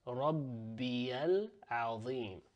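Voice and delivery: Rabbiyal Azim stretched slowly as one flow; slow Arabic liaison; slow joined recitation. Shown as a demonstration. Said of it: "So we're saying Rabbiyal".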